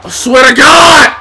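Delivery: high, loud outburst; real frustrated holler; exasperated shout. Real, not acted. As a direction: yell it at the game. Frustrated at failed pin.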